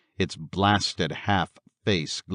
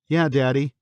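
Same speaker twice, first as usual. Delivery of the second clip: higher-pitched small daughter's voice; little girl crying out; 7 semitones higher, 3 dB louder than usual. A narrator's reading. Daughter to her daddy after his nightmare, crying out. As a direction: cry it out like an upset child. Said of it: "cried Missy".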